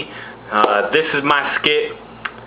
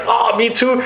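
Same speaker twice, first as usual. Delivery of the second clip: John Travolta; 10 semitones higher than usual. Acted. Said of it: "And John Travolta's like".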